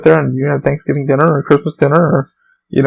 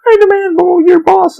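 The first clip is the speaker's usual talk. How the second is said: high, loud whiny demand for the boss; mock a customer demanding your boss; hammed-up Karen asking for the manager; entitled customer; 3 dB louder than usual. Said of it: "some people that are like".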